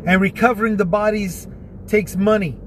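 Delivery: ordinary talk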